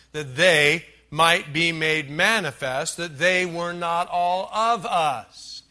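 slow, deliberate Bible reading; emphatic scripture reading. Acted. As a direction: drive the verse home word by word. Reads the verse aloud.

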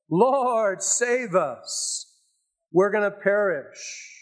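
high, pleading cry of the frightened disciples; panicked cry for help. Acted. Disciples waking Jesus in the storm, panicked plea. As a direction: cry out to be saved from drowning.

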